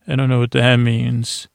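ordinary talk

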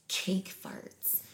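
breathy seductive whisper. Retold or imitated. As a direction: whisper it like a come-on.

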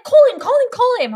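high, loud chant of call him; imitated excited urging. Office girls urging her to phone security, high excited chant. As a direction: push her to dial, squealing.

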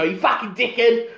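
high-pitched mock South African heckle; South African accent. West Ham fan abusing a passing driver, mock South African accent.

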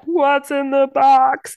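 high, loud, slow mock wail; retold film impression. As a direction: imitate someone doing an overblown movie quote.

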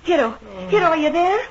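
high, loud call across the house; woman calling the servant to the sickbed, loud and high. Acted. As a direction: call for him urgently.

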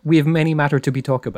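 quick, loud, high mock Greek accent; Russell Crowe. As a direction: rattle off broken English like a Greek immigrant impression. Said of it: "Why does he talk in a broken English accent?".

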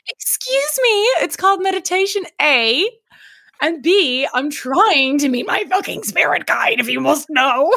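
high, haughty, offended; mock huffy defence; indignant. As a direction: put him in his place, prissy. Indignant reply to Evan.

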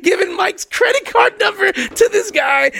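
high, half-crying, half-laughing, slowing at the end; laugh-cry through the panic of being scammed; laughing in disbelief. Real, not acted. Telling cohost about paying a stranger, laughing and crying at once.

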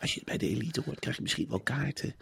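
very soft, teasing hedge; mock coaxing publisher. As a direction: murmur it as a dangled promise. Publisher teases authors with ball tickets.

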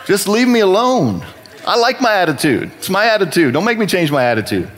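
high-pitched acted teenage protest; defensive retort. Resentful youth answering advice about his attitude, acted defensive protest. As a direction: push back indignantly, pitched well up.

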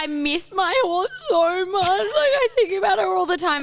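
high, loud, teary wail imitating mum; mock crying. Daughter mimics mum sobbing over her horse, high and teary. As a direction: blubber it like a grieving mum, hammed up. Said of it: "starts hysterically crying".

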